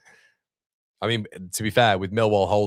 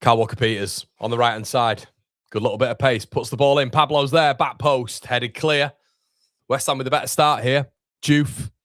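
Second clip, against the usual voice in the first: brisk, higher, louder commentary calling each pass; live play-by-play; 5 semitones higher, 4 dB louder than usual. Acted. Streamer calls the action to his viewers, excited commentary. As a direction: narrate the match as it happens, rising with the attack.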